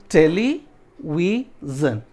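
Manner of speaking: टेलीविजन very slowly: टेली, वि, जन; syllable breakdown. Shown as a demonstration. Teacher breaking the word for students, syllable by syllable. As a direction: leave gaps inside टेलीविजन, टेली rising. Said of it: "इसको break कर देते हैं".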